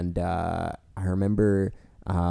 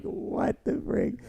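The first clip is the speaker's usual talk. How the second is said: bewildered under breath; 5 dB quieter, much slower than usual. Retold or imitated. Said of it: "I was like".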